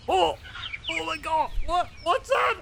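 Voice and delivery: hammed-up panic at something unseen; shocked. Austin in the outback spots a threat, shrieking surprise.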